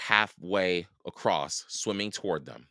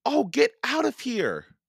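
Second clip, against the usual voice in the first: put-on high, loud girl's shout; indignant shout; 5 dB louder than usual. A narrator's reading. Annie at the circling vulture, shouting. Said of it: "Annie shouted at the vulture".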